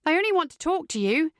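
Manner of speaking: high, sing-out appeal; coaxing call. A narrator's reading. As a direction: call out persuasively. Heroine keeps calling to him.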